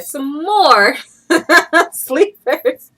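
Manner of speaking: loud laugh breaking into the words; loud, high and laughing; laughing excitedly. Real, not acted. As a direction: laugh out the word sleepers. Laughing at bright sleepers.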